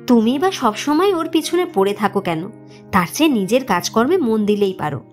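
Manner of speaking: usual speaking voice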